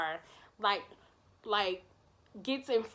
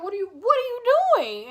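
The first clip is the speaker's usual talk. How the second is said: panicked plea; 10 semitones higher, 7 dB louder, faster than usual. Retold or imitated. Panicking as wife confronts her.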